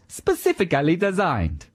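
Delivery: hammed-up Italian accent, high on "Specifically"; Aldo Zilli. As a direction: make great play of "Specifically", Italian-style. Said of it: "He says".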